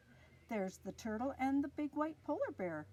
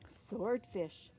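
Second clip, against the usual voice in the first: usual speaking voice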